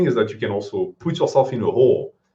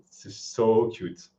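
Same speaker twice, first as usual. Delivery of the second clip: ordinary delivery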